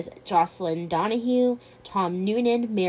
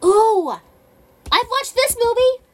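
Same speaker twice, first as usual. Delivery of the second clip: high, loud thrilled squeal; gushing over a favorite; excited; 4 dB louder than usual. Real, not acted. Recognizes a favorite movie.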